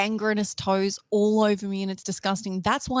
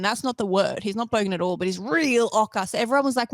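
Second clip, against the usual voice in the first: ordinary delivery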